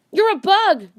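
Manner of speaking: high, loud shocked shout. Acted. Julie shouting at Neil after seeing he is a bug, high loud shout. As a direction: blurt it out in horror. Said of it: "Julie shouted".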